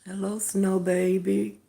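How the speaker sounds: normal talking voice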